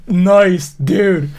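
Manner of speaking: loud, hyped-up "nice, dude"; mock bro cheer. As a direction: hype him up like a dudebro. Mock bro praising the sex claim.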